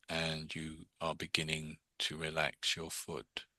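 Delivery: hushed, low robotic drone, drawn right out; slow monotone induction. Retold or imitated. Mimicking a fifties hypnotist relaxing a subject, slow monotone drone. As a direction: imitate a sleep-inducing old hypnosis tape. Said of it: "it's a slow, slow induction".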